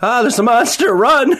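high, loud pretend scream; mock panic. Player jokes about a monster, hammed-up high panicked shout. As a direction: cry out in hammed-up terror.

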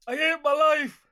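very high, loud whingeing wail; hammed-up moan, far above normal pitch; Deirdre Rashid. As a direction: wail it like a miserable soap character. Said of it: "we're all doing an impression of Deirdre Rashid".

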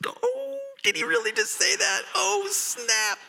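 squealing high oh snap; shocked gasp. Retold or imitated. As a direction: react like a gossiping crowd. Crowd stunned by Joash's retort.

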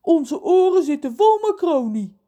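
loud, high-pitched holler; shouting. A narrator's reading. Reus explains his blocked ears, shouting. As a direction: bellow it like someone with blocked ears. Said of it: "schreeuwt Reus, die zijn eigen stem ook niet kan horen".

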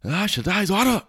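high, hammed-up Aragorn impression; mock Aragorn. Mocking Aragorn's speech to the lads, high and hammy. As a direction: camp up the noble king voice. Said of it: "Aragorn comes in and is like".

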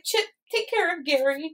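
a hint of cartoon melodrama; lightly acted plea. Host quotes cartoon hero entrusting his pet, lightly acted plea.

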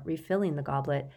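ordinary delivery

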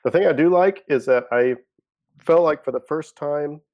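half-choking, voice straining; talking while choking on beer; choking on a drink. Real, not acted. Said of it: "I'm choking on Guinness".

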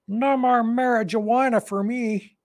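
high, slow put-on stoner drawl; a goofy impression of the rapper. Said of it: "That's not how he talks".

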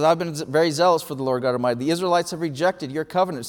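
fast, tumbling defensive rattle; rushed self-defence. Retold or imitated. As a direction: gabble the excuses to get them out. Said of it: "But Elijah starts, he says".

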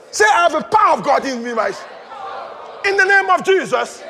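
full-force rallying preacher; preaching declarations. Real, not acted. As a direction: lead the crowd in bold declarations. Leads congregation in declarations.

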